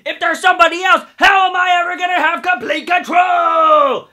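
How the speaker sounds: high, loud mock yell; imitating Barbara shouting. Retelling Barbara's outburst at Tabitha, shouting. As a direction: belt it as a power-hungry woman. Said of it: "She's like".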